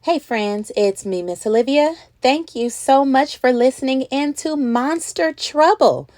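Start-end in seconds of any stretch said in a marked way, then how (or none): none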